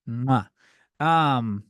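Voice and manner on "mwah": a quick smacking air kiss; kiss sound. Real, not acted. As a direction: blow a little kiss into the mic. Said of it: "that was an innocent hand kiss".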